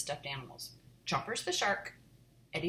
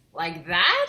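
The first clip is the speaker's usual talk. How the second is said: mocking; 13 dB louder than usual. Sister laughing at him.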